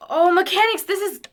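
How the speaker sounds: loud, high, delighted gushing; thrilled. Acted. Charlie marveling at the clockwork inside Winston, loud excited delight. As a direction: gush over it like a gearhead seeing treasure.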